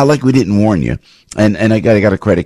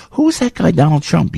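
put-on puzzled future kid; 10 semitones higher than usual. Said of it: "great-grandchildren are gonna go".

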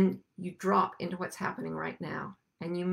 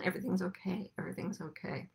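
soothing; 9 dB quieter, faster than usual. Retold or imitated. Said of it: "It's slowing down".

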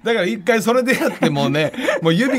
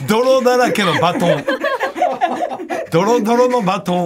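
after laughing hard; 4 semitones higher than usual. Real, not acted. Laughing while speaking.

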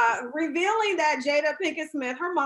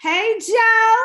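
excited greeting; 7 semitones higher, 8 dB louder than usual. Real, not acted. Host calls to guest.

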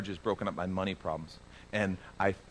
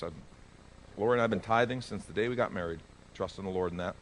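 ordinary talk, nothing marked

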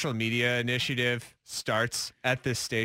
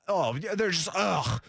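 mock disgust; 3 semitones higher, slower than usual. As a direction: imitate a put-off, disapproving coworker. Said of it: "Why would they say it in that tone?".

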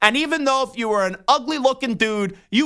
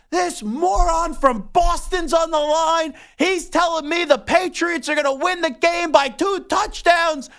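higher, slow, worked-up rant; an irate Jets fan venting about a caller; going nuts; 7 semitones higher than usual. Retold or imitated. A Jets fan screener ranting to the host about a caller, higher and worked-up. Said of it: "He's going nuts".

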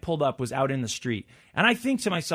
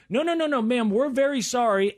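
apologetic pharmacist; 7 semitones higher than usual. Retold or imitated. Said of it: "she's like".

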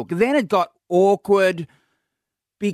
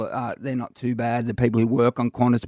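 normal talking voice

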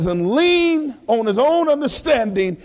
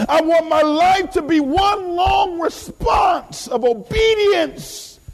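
high, stretched preaching cry; impassioned preaching; 11 semitones higher, slower than usual. Real, not acted. Preacher declares obedience to God, high and impassioned. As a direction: draw the words out high and forceful.

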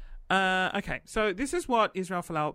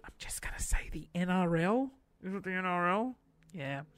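softer, unsure whisper; whispered aside; 6 dB quieter than usual. Real, not acted. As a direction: mutter it as a private aside. Unsure aside about league name.

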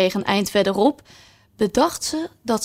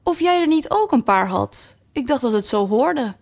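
curious rose; 6 semitones higher than usual. Acted. Rose wonders about Alice's points.

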